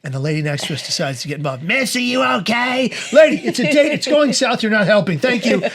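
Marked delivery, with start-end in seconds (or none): 1.6-2.9 high, slow, concerned busybody; meddling diner. Retold or imitated. Lady at next table checking on his date, meddling. Nosy neighbouring diner butts in. 3.1-5.6 loud, high-pitched comic irritation; exasperated retort. Retold or imitated. Flustered man telling the next table to butt out, exasperated. Snaps back at meddling diner.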